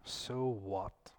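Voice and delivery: slow, soft, indifferent huh; apathetic shrug. Retold or imitated. People shrugging off talk of Jesus, indifferent. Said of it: "many of them will go".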